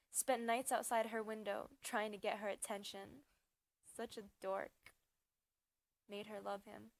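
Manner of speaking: ordinary talk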